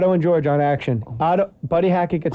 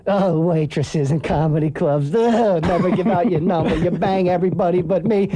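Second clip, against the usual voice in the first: Andrew Dice Clay. Retold or imitated. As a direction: gripe as a lightly voiced stand-up. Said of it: "he's on stage, then ten minutes in".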